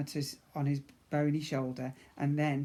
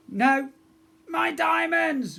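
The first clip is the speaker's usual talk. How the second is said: high, slow, drawn-out whine; whining; 13 semitones higher, 9 dB louder, much slower than usual. Acted. Prince whining as his diamonds are taken, high and slow. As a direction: wail over the lost diamonds. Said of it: "Grogber whined".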